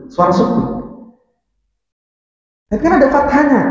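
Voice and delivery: ordinary talk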